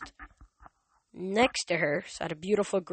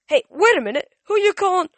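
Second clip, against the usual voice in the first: much higher, loud angry yell; offended shout; 13 semitones higher, 14 dB louder than usual. A narrator's reading. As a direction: bristle at the insult. Ares takes offense.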